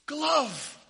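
high, loud, slow command; mock magician. Pastor redoing the lame trick for the congregation, hammed-up. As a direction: ham up the spell for the congregation. Speaker now performs the trick.

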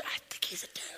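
hushed paranoid whisper. Retold or imitated. Husband whispers his fear to his wife, whispering. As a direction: lean in and whisper it. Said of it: "And I'm like".

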